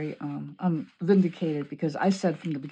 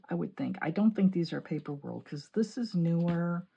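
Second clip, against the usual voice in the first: ordinary delivery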